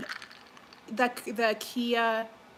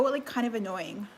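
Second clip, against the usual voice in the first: normal talking voice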